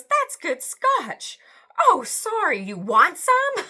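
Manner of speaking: a hint of a smug intruder, drawn out; lightly voiced stranger. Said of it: "he goes".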